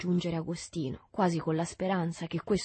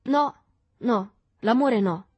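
earnest, louder correction; high, scrupulous denial; conscientious; 5 semitones higher, 9 dB louder, slower than usual. A narrator's reading. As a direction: object earnestly, setting it straight. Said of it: "disse Agostino coscienzioso".

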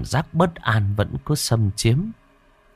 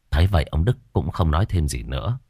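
ordinary delivery

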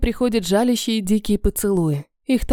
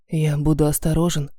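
мужской голос; 6 semitones lower than usual. Acted. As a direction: lower male voice, gentle. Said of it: "сказал он". (низкий мягкий мужской голос)

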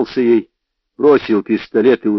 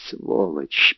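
muttered through teeth; 5 semitones lower, 5 dB quieter than usual. A narrator's reading. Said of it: "процедил он сквозь зубы".

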